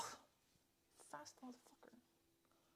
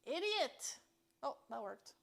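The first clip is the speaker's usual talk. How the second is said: ordinary talk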